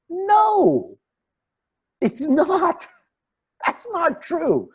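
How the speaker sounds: very high, squeaky contradiction; mock protest. Speaker rebuts that anger is natural, mock protest. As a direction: push the denial up into a comic squeal.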